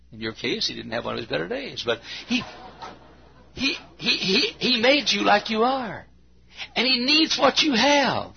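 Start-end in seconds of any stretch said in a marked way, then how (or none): 2.3-8.3 tearful preaching. Acted. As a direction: preach it through tears. Emotional preaching climax.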